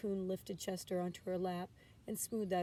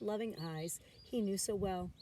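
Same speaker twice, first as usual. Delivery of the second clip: usual speaking voice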